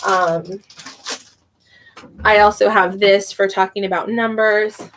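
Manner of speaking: ordinary delivery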